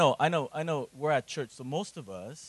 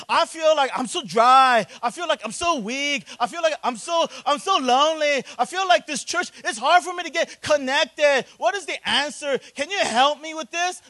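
pleading, high and louder churchgoer; needy congregant; 13 semitones higher, 10 dB louder than usual. Retold or imitated. Congregant pleading with Pastor Brian for counseling, high and loud. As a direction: beg the pastor for help.

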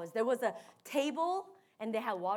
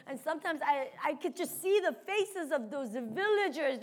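usual speaking voice

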